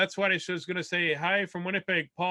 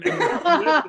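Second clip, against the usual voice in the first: much higher, louder savoring exclamation; mock delight; 11 semitones higher, 8 dB louder than usual. Comic savors the gravy punchline, hammed-up high loud delight. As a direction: relish it over the top.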